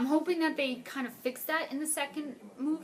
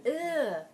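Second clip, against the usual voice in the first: louder, higher groan of disgust; 4 semitones higher, 4 dB louder than usual. Real, not acted. Fan cringing at a corny line, disgusted groan. Reacting to a cheesy movie line.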